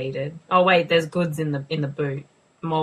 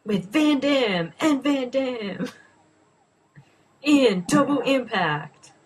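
higher, slow, booming movie-trailer narration; trailer voiceover; 7 semitones higher, slower than usual. Pretend. Mocking Double Impact as a dramatic trailer voiceover. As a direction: announce it like a blockbuster trailer. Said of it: "That was my trailer voiceover".

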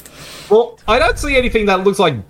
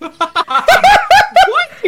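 squealing high through laughter; laughing; 5 dB louder, much slower than usual. Real, not acted. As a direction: laugh the words out. Watching suits scene, laughing.

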